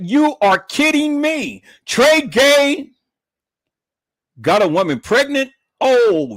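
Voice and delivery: high, hammed-up disbelief; incredulous. Retelling disbelief at rival having kids.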